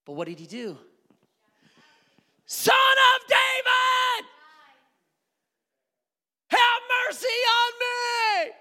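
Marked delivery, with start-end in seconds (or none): 2.5-8.5 high, slow, stretched-out plea; desperate shouting. Acted. Blind beggar begging Jesus for mercy, shouting. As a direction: cry it out, drawing each word long. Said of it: "he begins shouting".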